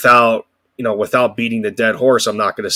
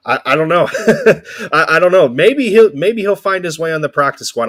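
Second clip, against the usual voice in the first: laughing; 7 semitones higher, 5 dB louder than usual. Real, not acted. Laughing through uncertainty.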